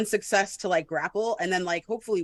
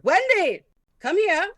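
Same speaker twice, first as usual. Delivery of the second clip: high, loud call to a companion; shout over shoulder; 11 semitones higher, 6 dB louder than usual. Acted. Calling Wendy over to the captive, high shout. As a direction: call someone over from a distance. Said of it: "I shout over my shoulder".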